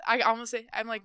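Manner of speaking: normal talking voice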